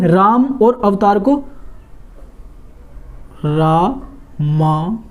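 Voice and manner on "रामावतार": रामावतार drawn out slowly, syllable by syllable; slow dictation of रामावतार; slow spelled-out demo. Shown as a demonstration. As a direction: stretch रामावतार out slowly as if writing it on the board. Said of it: "तो ये हम इसको कैसे लिखेंगे?".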